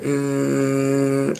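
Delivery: long, held, high monotone "ehhh"; sustained high noise on one note; imitated buzz. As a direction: mimic a droning electric buzz. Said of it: "like that".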